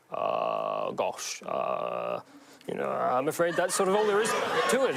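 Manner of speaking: lightly voiced bumbling Englishman, halting; Hugh Grant. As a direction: hesitate with a hint of apologetic fluster. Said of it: "you did a Hugh Grant impression".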